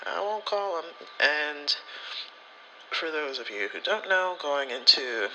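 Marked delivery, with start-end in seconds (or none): 0.1-0.9 higher, lightly mocking obedience; a touch of a chastened yes; mock-obedient reply, pitched up; meek agreement. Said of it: "and I'm like".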